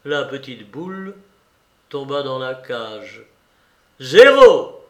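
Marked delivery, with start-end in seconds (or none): 4.0-4.8 very loud, high ringing shout; explosive casino call; croupier's cry. A narrator's reading. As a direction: shout the winning result out. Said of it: "cria le croupier".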